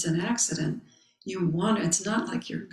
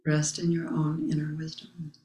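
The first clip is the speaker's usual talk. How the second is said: calm mantra. Acted. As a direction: let each line settle like a meditation. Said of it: "my own mantra".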